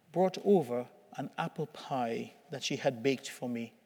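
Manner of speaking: ordinary talk, nothing marked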